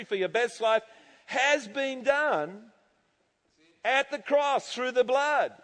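emphatic preaching. Real, not acted. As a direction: drive each point home from the pulpit.

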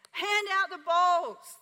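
slow, loud, high command. Retold or imitated. Heidi commanding the crowd: hand out bowls. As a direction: declare it slowly and boldly. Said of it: "and she just said".

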